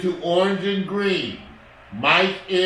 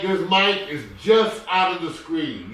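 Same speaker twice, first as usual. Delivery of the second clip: ordinary delivery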